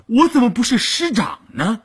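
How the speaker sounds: high, insistent rhetorical question; insistent retort. A narrator's reading. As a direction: repeat it indignantly, pitched right up. Repeats the retort more insistently.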